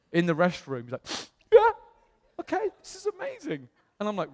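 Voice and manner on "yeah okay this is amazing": high, tearful squeak; imitated crying. Church founder moved to tears in the restroom, mock weepy. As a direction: act someone choked up and crying, pitched way up. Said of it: "he starts to cry".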